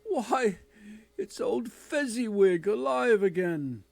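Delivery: loud, high, delighted cry; overjoyed to see an old master; great excitement. Acted. Scrooge seeing old Fezziwig again, loud delighted cry. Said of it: "cried with great excitement".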